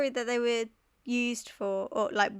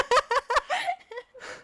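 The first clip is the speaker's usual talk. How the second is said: high laugh. Real, not acted. Laughing at a shared joke.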